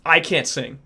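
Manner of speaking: ordinary delivery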